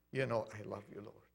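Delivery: low, soft, subdued reply; humble answer. Retold or imitated. Peter assuring the Lord of love, humble answer. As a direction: drop right down and answer meekly.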